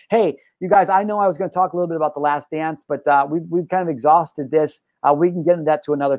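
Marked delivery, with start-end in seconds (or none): none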